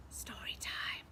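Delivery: whispered story time. Acted. Storyteller whispering to children that it's story time. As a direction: breathe it out secretively.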